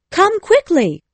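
high, loud urgent summons; crying out. A narrator's reading. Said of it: "she cried out".

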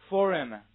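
"Forum" for "for him", h dropped and words joined; loud model of "Forum", meaning for him without the h; linking through h. Shown as a demonstration. Teacher drilling a phrase confused with forum, loud linked reading. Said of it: "read after me".

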